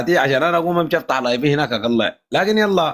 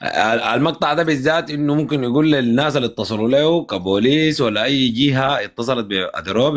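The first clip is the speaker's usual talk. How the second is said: ordinary delivery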